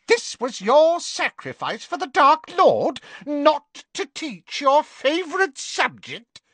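high mocking witch taunt; jeering. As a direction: sneer it out. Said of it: "she jeered".

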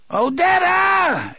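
desperate scream of her name, very high. A narrator's reading. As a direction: scream it out across the hills, drawn out. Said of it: "Eddie screamed".

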